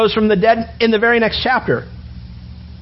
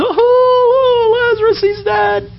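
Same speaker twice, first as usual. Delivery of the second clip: high keening wail, much higher and louder; mourners wailing; 3 dB louder, much slower than usual. Acted. Mourners at the tomb crying out over Lazarus, high wail.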